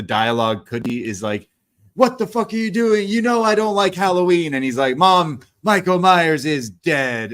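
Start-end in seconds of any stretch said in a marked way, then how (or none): 1.9-4.5 high-pitched melodramatic outburst; overdramatic. Retold or imitated. Mom confronts her son over Halloween, overdramatic. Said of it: "a little overdramatic". 5.0-7.3 slow, higher melodramatic protest; overdramatic. Retold or imitated. Son tells mom the killer is gone, overdramatic. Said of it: "a little overdramatic".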